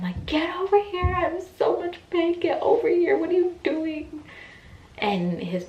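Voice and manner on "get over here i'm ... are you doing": high, loud pained yell at her husband; a woman in labour yelling at her husband to get over here; screaming at husband. Retold or imitated. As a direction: scream it at him mid-contraction, demanding. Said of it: "I would scream at him".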